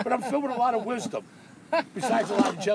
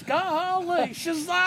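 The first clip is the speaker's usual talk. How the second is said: high, twangy Gomer Pyle gee-whiz, much higher; Jim Neighbors impression; 10 semitones higher, much slower than usual. Poking fun at a sixties star, imitating his high gee-whiz voice. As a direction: gush it like a wide-eyed country boy. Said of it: "Jim Neighbors".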